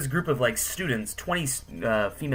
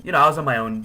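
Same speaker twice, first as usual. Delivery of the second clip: ordinary delivery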